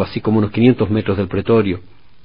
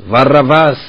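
crowd shout; 5 semitones higher, 6 dB louder, slower than usual. Retold or imitated. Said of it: "que grita la multitud".

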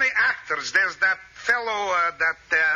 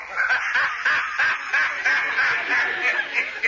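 long high cackling laugh; laughing fit; 5 semitones higher than usual. Acted. Professor laughing to Mel at Bugs Bunny, long laugh. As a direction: laugh helplessly at the memory.

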